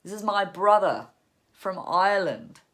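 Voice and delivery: loud, high, proud announcement; beaming introduction. A narrator's reading. Brother introduces him in the pub, beaming and loud. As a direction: announce it to the room with a big smile. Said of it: "introduces me with a great beaming smile".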